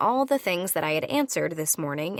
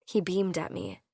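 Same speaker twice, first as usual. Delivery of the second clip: plain reading voice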